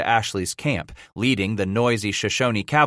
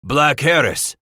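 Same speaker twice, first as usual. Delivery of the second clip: loud, raised roar of a name; 4 semitones higher, 5 dB louder than usual. A narrator's reading. Old German naming the man described, roaring. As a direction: boom out the name. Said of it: "Frabe roared".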